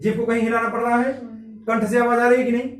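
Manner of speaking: ordinary talk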